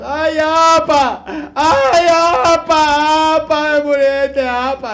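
high, loud, drawn-out mock wailing; hired mourners wailing. Imitating hired mourners at a wake, hammed-up wailing. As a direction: ham up a paid mourner's lament. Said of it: "hacen un escándalo terrible".

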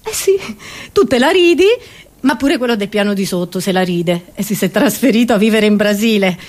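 usual speaking voice

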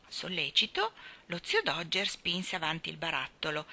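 ordinary delivery, nothing marked

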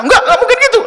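shrill refusal, hammed up; stubborn wife denial. Preacher imitating a wife who won't believe her husband, high and loud.

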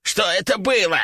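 gasping, wheezing parrot voice; breathless wheeze. A narrator's reading. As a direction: still winded, wheeze the question. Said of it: "задыхаясь, прохрипел он".

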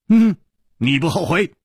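light, amused question; said with a smile. A narrator's reading. Chu Liuxiang teases Shuimu about her offer, smiling. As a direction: ask it with a smile in the voice. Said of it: "楚留香笑着说".

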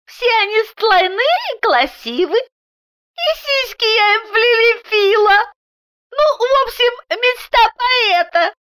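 put-on very high lisping child voice; little girl. A narrator's reading. Daughter describes the fixed women to papa, in child voice. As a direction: report it proudly as a small child.

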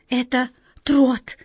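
breathless slow gasp of shock. A narrator's reading. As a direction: catch your breath and say it. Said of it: "задохнулась Ниточка".

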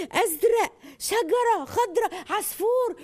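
put-on high, bright, slow naming of words for a baby; cheerful naming to baby. Shown as a demonstration. Mother naming colours and things to a baby, high and cheerful. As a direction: call out each word high and happy for the baby. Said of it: "سن سنة ده الألوان".

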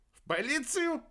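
very high, startled question; surprised. A narrator's reading. Aerba hearing the women were taken away, surprised. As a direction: shoot the pitch up in astonishment. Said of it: "удивился Уэрба".

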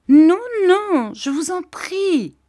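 put-on very high girlish cry; shrieked, pleading protest. A narrator's reading. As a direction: cry out high, then drag the plea. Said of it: "cria Dora".

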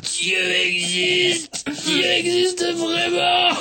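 high, slow ecstatic cry; overjoyed. Acted. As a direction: praise heaven, delighted. Overjoyed at the news.

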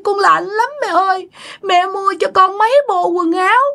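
put-on high, plaintive drowned-child voice; Tí's child spirit. Dead child telling mother of being drowned, child voice. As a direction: lament to mother like a small wronged child. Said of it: "chú Tí này á, nhập vô xác".

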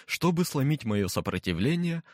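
plain reading voice

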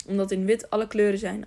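ordinary delivery, nothing marked

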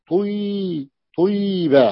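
طيبة said as tuy-ba, with u before the y; original unchanged form. Shown as a demonstration. Teacher showing students the root form behind طوبى, sounded out as tuy-ba. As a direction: sound out طيبة in two parts, "tuy" then "ba". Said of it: "هذا الأصل".

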